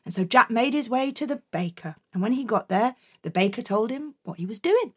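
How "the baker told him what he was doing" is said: plain reading voice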